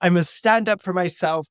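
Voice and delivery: high, loud, overblown refusal; gallant teen. Acted. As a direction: declare it nobly like a young hero. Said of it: "fifteen-year-olds get gallant".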